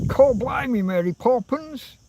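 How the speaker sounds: high-pitched put-on Cockney; best mock Cockney. Imitating Dick in Mary Poppins, mock Cockney accent. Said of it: "That's my best Cockney accent".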